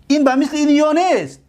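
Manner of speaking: ordinary talk, nothing marked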